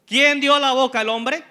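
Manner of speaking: God's question thundered loud and high; booming voice of God. Acted. God asking Moses who made his mouth, booming and loud. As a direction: boom it out as God demanding an answer.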